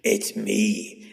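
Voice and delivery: low, soft sinister reveal; hushed low menace; creepy monster voice. Acted. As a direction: announce yourself as a looming monster. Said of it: "that's not Tiny".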